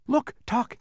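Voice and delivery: high, excited call; excited cry. Acted. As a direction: call out in delight. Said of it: "he cried".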